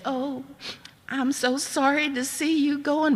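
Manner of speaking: a mother pretending to be sad to see you go; mock sorrow. Mother's fake regret at parting.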